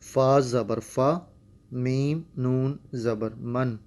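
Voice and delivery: slow syllable spelling; spelled-out Quran reading. Shown as a demonstration. Teacher starting the ikhfa example word. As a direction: spell fa and min slowly. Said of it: "غنہ کر کے پڑھیں گے".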